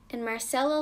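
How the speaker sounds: plain reading voice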